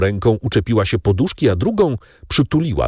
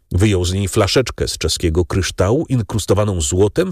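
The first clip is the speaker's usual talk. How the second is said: ordinary delivery, nothing marked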